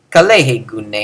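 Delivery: low, level "Kalehe gune"; same low pitch. Shown as a demonstration. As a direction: hold "Kalehe gune" down as one phrase. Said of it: "They have that same pitch".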